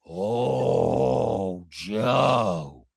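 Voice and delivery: very low, slow drawn-out mock reply; Cirie impression. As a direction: stretch both words out long and deep, imitating her. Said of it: "That's all she said".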